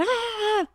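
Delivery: a quick high mock shout "Ah!"; mock yell at child. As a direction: imitate a parent snapping with a shrill-free high cry. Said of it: "chega gritando".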